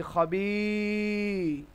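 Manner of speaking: "খবি" with a very long final i; "খবি", last vowel held; long vowel spelling. Shown as a demonstration. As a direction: stretch the i of "খবি" on a steady note. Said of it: "খ জবর খ, বা ইয়া জের বি".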